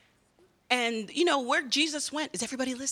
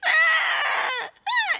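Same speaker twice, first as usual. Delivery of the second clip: put-on high, loud baby wail; imitated baby cry; 7 dB louder than usual. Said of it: "They just tell you".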